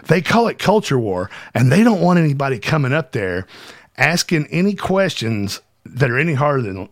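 ordinary delivery

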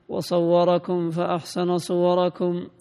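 ordinary delivery

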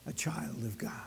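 soft, unvoiced whisper. Real, not acted. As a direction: breathe it as a hushed whisper. Whispered reflection.

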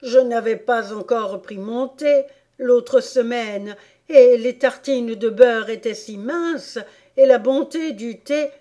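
worked-up, anxious pleading; emotional. Acted. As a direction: plead your case with feeling. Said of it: "d'une voix émue".

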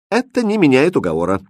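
usual speaking voice

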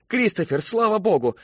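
high, loud cry of relief; girl cries out in relief; relieved exclamation. A narrator's reading. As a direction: call out his name in relief. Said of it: "воскликнула Мэри Кэтрин".

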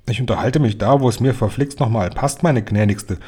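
ordinary delivery, nothing marked

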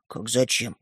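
low, soft echoed question; meek puzzlement. Acted. Стёпа explaining the gift to the brothers, meek. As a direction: answer the outcry small and quiet. Boy answers their outcry meekly.

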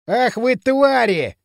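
slow, drawn-out furious shout; enraged yell. A narrator's reading. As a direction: spit it out in sudden rage. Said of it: "заорал он". (медленный яростный крик)

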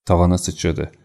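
plain reading voice

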